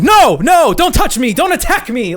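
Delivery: high, loud imitation of a man fleeing; mock panic. Host imitating Bob dodging a contestant's hug, mock panic. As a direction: fend them off in hammed-up alarm. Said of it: "Bob is running away from them".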